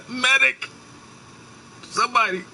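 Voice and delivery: high yelled call for help; man down shout. Pretend. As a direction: holler for anyone to come. Calling out for a medic.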